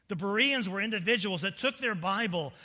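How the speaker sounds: loud, high, emphatic pulpit voice; full-force preaching. Real, not acted. Preacher holds up the Bereans as example, at full force. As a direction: hammer it home to the congregation.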